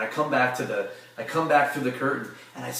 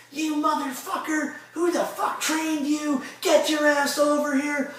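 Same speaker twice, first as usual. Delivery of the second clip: slow, very high-pitched tirade; drawn-out, high mock yelling; retold furious chewing-out; slower than usual. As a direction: imitate an irate boss dressing someone down. Same retold chewing-out, replayed.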